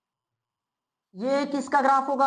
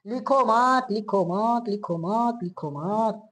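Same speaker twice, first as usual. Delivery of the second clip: drawn-out, repeated instruction, sinking in pitch; repeated admonition; 2 semitones lower, much slower than usual. Real, not acted. Teacher stopping students from writing, a drawn-out repeated admonition.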